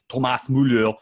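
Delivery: English pundit overdoing the German on Thomas Müller; exaggerated German accent on the name; pronounced German accent. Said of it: "they say the names in such a pronounced accent".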